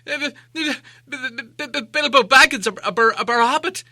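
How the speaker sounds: high, stammering frightened hobbit; terrified stammer. Acted. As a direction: stutter out in panic, shaking all over. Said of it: "shaking all over".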